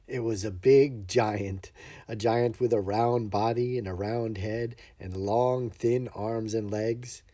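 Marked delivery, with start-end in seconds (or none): none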